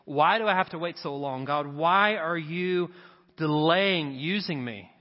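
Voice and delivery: usual speaking voice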